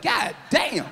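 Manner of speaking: sudden shocked shout; shocked exclamation. Retold or imitated. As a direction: blurt it out in disbelief. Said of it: "I was like".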